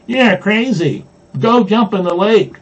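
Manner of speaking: high-pitched storyteller's yell, not full volume; mild yell. A narrator's reading. Blue Jay children answering each other rudely, mild yelling. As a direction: yell it lightly, pitched well up. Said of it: "when they're not yelling something worse".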